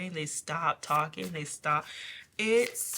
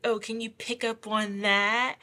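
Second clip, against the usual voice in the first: louder, higher mock taunt; 4 semitones higher, 5 dB louder than usual. Bullies testing an intuitive person for fun, taunting. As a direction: goad them, imitating a teaser. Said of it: "Like, that type of thing".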